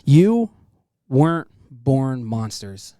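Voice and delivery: loud, slow, rising to a shout; emphatic shout. Real, not acted. Host telling the slider he was made a monster, shouting slowly. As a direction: hit it hard and slow, like a rallying cry. Emotional tribute to the guest.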